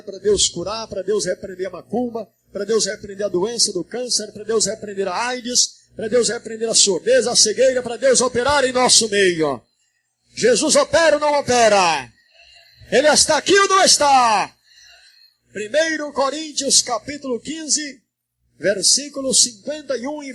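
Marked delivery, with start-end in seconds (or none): 5.9-14.6 preaching that builds into loud calls; rousing preaching. Real, not acted. Preacher rallying the congregation for God to work, building to a shout. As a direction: drive the congregation up to a shouted challenge.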